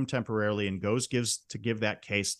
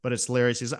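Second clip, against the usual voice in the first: usual speaking voice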